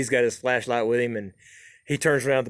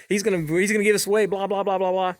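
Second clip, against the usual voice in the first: mock grumbling; 7 semitones higher than usual. Eddie still complaining about boy.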